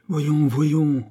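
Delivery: acted exasperated exclamation; beside himself. A narrator's reading. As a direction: protest against an outrageous accusation. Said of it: "jeté hors de lui-même".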